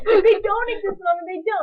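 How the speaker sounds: put-on high, loud childish protest; insistent child. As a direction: plead it like a stubborn little boy. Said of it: "He was so convinced".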